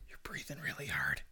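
low, hushed whisper; barely audible joking whisper; teasing whisper. Pretend. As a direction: whisper it as a sly aside. Teasing aside after being hushed.

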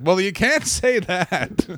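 slow, laughter breaking into the words; can't keep a straight face retelling it; half-laughed producer objection; laughing through it. Retold or imitated. Retold producers, laughing mid-line.

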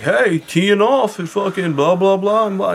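high, loud, excited rattle of imitation; mock sales-pitch texts. Reading out old contacts' texts, hammed-up hype. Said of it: "I was getting the text like".

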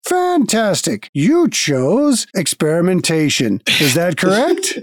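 trolling automaton. Acted. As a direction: feign machine politeness while mishearing. Automaton keeps mishearing.

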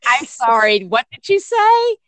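high, loud teasing can't-hear-you; playful mock confusion. Mom teasing her whining child, high and playful. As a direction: pretend not to understand, teasing. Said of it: "You know".

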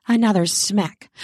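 plain reading voice

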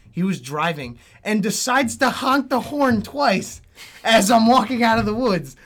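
ordinary delivery

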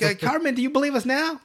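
high-pitched cartoon-kid questioning; imitated challenge. Retold friends challenge Cartman, high imitated questioning. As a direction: pitch it up, pressing him for an answer.

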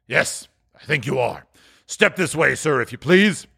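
gruff boss demanding an explanation; feigned growl. Acted. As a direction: scold in a put-on grumpy voice. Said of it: "in his accustomed voice, as near as he could feign it".

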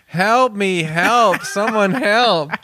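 high, hammed-up call for help; mock cry for help. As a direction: yelp it like a pretend victim.

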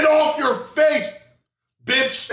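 hammed-up yell; shouted. Shown as a demonstration. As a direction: yell the saying. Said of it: "Get that".

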